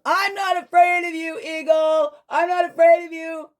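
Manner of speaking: high, loud defiant yell at the sky; defiant shout. Acted. Rabbit taunting Eagle overhead, a high loud shout. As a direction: shout it up at the eagle, puffed-up brave. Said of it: "Rabbit goes out and starts shouting".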